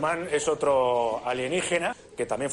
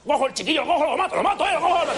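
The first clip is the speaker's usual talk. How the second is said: furious yell; 12 semitones higher, 4 dB louder, faster than usual. Acted. Enraged Albacete farmer yelling.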